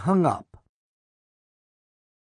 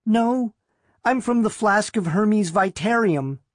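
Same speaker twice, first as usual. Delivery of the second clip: put-on high, meek girl's voice; small, higher-pitched voice; timid girl; 8 semitones higher than usual. A narrator's reading. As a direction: answer meekly, pitched well up. Timid girl answers the librarian.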